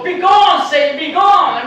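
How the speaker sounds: high, loud mock priest banishing a demon; mock exorcism. Speaker banishing the demonic homeless man, loud exorcist cry. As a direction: cast out Satan with hammed-up force. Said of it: "take out my flash of holy water and go".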